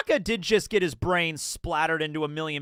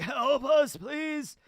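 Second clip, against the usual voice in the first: lightly voiced dying soldiers begging, high pitched; a faint, pitched-up beg for help from the dying; hammed-up dying plea; 8 semitones higher, much slower than usual. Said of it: "members of Cypher Pole are like".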